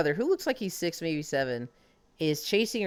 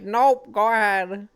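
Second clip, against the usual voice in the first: high, louder imitation of a brush-off; mock dismissal; 5 semitones higher, 7 dB louder, slower than usual. Mimicking friend declining spoilers, loud breezy dismissal. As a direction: imitate a friend cheerfully not caring.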